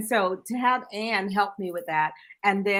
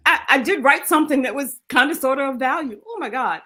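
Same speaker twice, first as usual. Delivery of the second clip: higher, louder, gleeful; laughing joy; 7 semitones higher, 5 dB louder than usual. Real, not acted. Author laughing with joy about her book's reach. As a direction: bubble over with pride.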